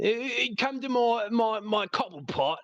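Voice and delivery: high, hammed-up Cockney bloke; playful exaggerated Cockney voice; Cockney accent. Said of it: "What's a man cave in a Cockney accent?".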